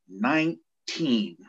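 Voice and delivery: put-on NINE-TEEN stretched very slowly, falling; drawn-out echo. Captain echoes the young recruit's age, drawn out for effect. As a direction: repeat it slowly, letting each syllable sink in.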